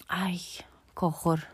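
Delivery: slow horrified whisper. Real, not acted. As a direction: whisper it, appalled. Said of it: "olhar para as minhas unhas".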